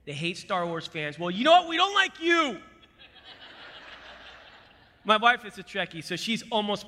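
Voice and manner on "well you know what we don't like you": loud, high-pitched playful taunt; hammed-up rivalry; mock hostility. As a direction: rise into a pretend angry jab at rival fans. Mock feud with Trekkies.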